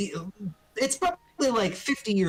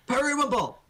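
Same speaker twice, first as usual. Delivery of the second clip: calling out; 5 semitones higher than usual. Acted. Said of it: "he calls for Periwinkle and says".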